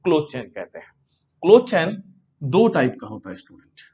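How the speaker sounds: normal talking voice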